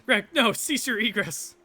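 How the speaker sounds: high, loud, slow plea; panicked shout. Acted. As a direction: call out in alarm. Panicked call after someone.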